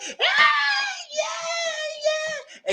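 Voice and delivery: high squealed mock whoop; imitated party cheer. Bride picked up by a stripper, mock excited squeal.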